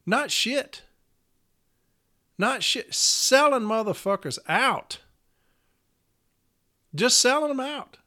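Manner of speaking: high, heated ranting; indignant union rant, voice climbing; angry outburst. Real, not acted. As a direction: spit it out in real anger. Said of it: "I'm just angry".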